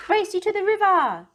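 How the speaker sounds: high, loud racing call; a horse yelling a race challenge; shouted challenge. Acted. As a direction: shout the dare across the meadow.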